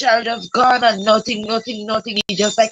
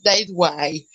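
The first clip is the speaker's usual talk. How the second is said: ordinary talk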